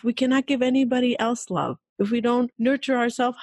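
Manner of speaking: ordinary talk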